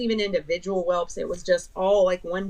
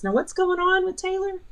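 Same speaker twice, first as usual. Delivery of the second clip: lightly voiced nurse, pitched up high; a bright, high nurse's question; imitating the nurse; 11 semitones higher than usual. Nurse comes on the line.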